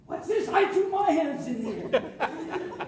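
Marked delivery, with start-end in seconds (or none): 0.1-0.5 startled question. Retold or imitated. Reacting to cop's raised hands.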